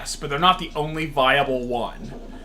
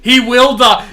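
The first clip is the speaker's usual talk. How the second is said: louder, higher menacing line; emphatic threat; 5 semitones higher, 9 dB louder, slower than usual. Retold or imitated. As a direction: deliver it as a real threat. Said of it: "It wasn't just the little".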